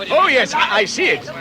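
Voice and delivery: sudden excitement at seeing the light; excited. Acted. Thorin spots the distant light and exclaims.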